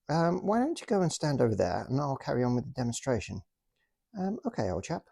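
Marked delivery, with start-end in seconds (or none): 4.2-5.0 posh officer voice. Pretend. Toy soldier agrees, voiced.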